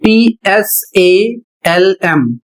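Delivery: पीएसएएलएम spelled letter by letter, very slow; spelled out slowly. Shown as a demonstration. Teacher spelling the word slowly for students. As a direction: read out each letter slowly. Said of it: "अगला शब्द है".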